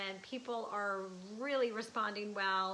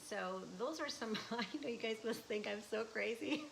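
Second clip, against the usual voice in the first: ordinary talk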